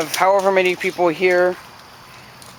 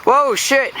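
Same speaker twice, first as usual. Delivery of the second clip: startled; 9 semitones higher than usual. Real, not acted. Startled while walking out.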